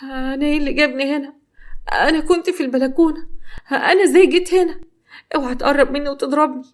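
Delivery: startled awake and terrified of him; frightened. Acted. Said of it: "وقالت له بخوف". (بخوف وبصوت عالي مذعور)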